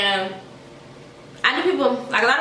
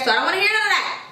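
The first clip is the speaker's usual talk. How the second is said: pitched way up, telling viewers to stop; high emphatic warning; 10 semitones higher, faster than usual. Real, not acted. Warning her viewers away, with a high emphatic voice. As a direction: draw the line sharply, high.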